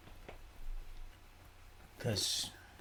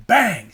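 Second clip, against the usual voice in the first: a sudden shouted "Bang!", much louder; loud bang imitation; 7 semitones higher, 18 dB louder than usual. Man retelling a truck being hit, imitating the bang loudly. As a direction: blurt it out like the crash itself.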